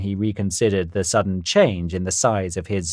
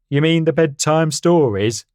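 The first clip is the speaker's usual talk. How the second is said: louder, boastful stallion; confident horse voice; 6 semitones higher, 5 dB louder than usual. Acted. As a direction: puff up like a proud horse. Harry voiced in story.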